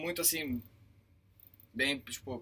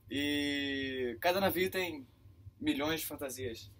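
ordinary talk

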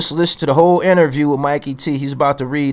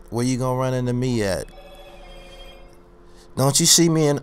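normal talking voice

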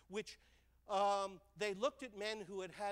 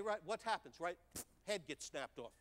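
usual speaking voice

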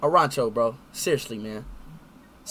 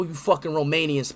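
ordinary delivery

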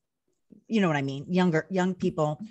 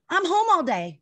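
high, louder retort; mock indignant protest; 11 semitones higher, 5 dB louder than usual. Wife explains to husband why dogs prefer her, mock indignant. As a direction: protest the obvious, pitch leaping up.

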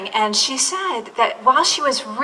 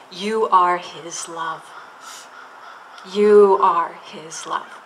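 slow tender reading; 3 semitones lower, slower than usual. Acted. Reading affirmation slowly.

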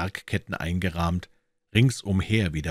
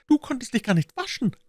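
put-on high-pitched child breaking in; very high little-kid voice; earnest little girl. A narrator's reading. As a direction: interrupt as a serious small girl. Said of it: "ihm ernsthaft in das dunkle Gesicht blickend".

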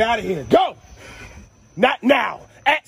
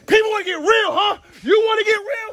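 higher, louder hype call; rising excited shout; hyped shout; 6 semitones higher, 4 dB louder than usual. Acted. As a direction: fire up the crowd. Hyping the crowd.

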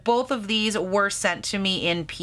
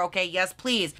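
ordinary delivery

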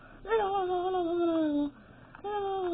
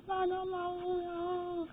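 drawn-out groan; 3 semitones higher, 8 dB quieter, much slower than usual. Real, not acted. Dragged out in dismay.

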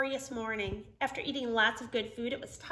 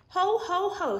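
louder, higher hearty laugh; jolly ho ho ho; 8 semitones higher, 8 dB louder than usual. Acted. Santa cheers the team after repairs, jolly. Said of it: "said Santa".

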